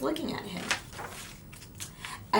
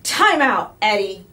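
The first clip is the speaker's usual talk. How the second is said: stern teacher; 9 dB louder than usual. A narrator's reading. Teacher scolding Eddie.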